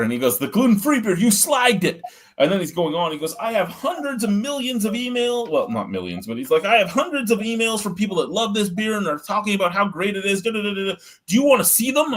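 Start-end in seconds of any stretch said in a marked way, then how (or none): 0.4-2.0 much higher, louder mock brewer voice; indignant brewer. Brewer confronting the reviewer who slagged his beer, high mock voice. 3.4-5.5 indignant brewer. Retold or imitated. Brewer confronting the reviewer who slagged his beer, high mock voice. Brewer brags about emails. 6.6-12.1 indignant brewer. Retold or imitated. Brewer confronting the reviewer who slagged his beer, high mock voice. Brewer ranting about fan emails.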